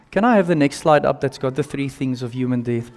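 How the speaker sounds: ordinary delivery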